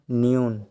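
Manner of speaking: न्यून said "nyoon", the mistaken way; wrong reading. Shown as a demonstration. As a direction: give न्यून as the error to avoid. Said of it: "न्यून नहीं पढ़ा जाता है". (गलत उच्चारण, न्यून)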